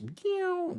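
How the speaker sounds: squeaky high car zoom; high swerve noise. Pretend.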